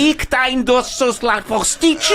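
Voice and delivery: mock German tirade building to a shout; ranting in fake German at the staff; angry German rant. Otto rants at the spoiled Americans in fake German. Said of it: "I just get so excited".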